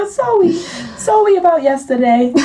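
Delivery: put-on high babyish sowwy. Mocking the boyfriend's text in baby talk. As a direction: coo the apology mockingly. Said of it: "the baby voice can be funny".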